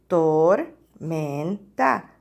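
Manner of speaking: "Tormenta" in three slow, low, separated syllables. Shown as a demonstration. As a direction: break "Tormenta" into tor, men, ta. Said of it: "cuál es la primera sílaba".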